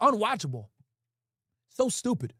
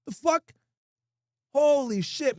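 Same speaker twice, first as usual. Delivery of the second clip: usual speaking voice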